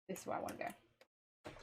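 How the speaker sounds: breathy whisper, almost unvoiced; whispered to herself. Real, not acted. Vlogger whispering to herself as she picks. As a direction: whisper the choice under the breath. Reading choice aloud to self.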